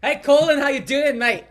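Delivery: loud, high Scottish greeting; big, bouncy Scottish impression; put-on Scottish accent. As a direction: hail your mate in an exaggerated Scottish accent. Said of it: "your Scottish accent is pretty good".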